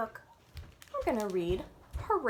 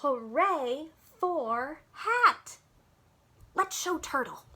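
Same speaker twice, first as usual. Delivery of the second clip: slow, louder cheer; slow, louder joint cheer; 3 semitones higher, 5 dB louder, slower than usual. Acted. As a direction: cheer together slowly with the child. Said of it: "Can you cheer with them?".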